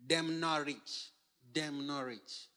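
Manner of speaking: slow Jamaican Patois phrasing; soft, slow Patois-style delivery; Jamaican accent. Shown as a demonstration. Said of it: "say it in, like, the accent".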